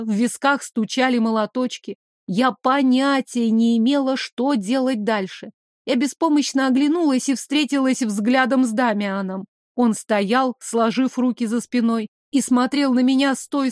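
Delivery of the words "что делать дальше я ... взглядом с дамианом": ordinary delivery, nothing marked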